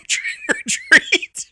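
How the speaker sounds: Trick or treat squeaked very high and slowly; laughing squeak. Retold or imitated. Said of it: "you pronounce the R".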